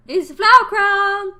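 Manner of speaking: high, loud, drawn-out exclamation; excited announcement. Acted. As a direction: crow it slowly with delight. Showing off a flower crown.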